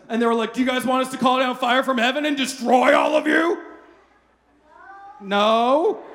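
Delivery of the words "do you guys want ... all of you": raised, louder, eager zealot voice, played for laughs; hammed-up offer to rain down fire; mock fiery disciples. Pastor mimicking hotheaded disciples offering to call down fire.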